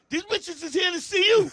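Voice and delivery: high, loud shouted protest; acted indignant promoter. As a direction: imitate a promoter yelling at his act. Promoter refuses to let him leave.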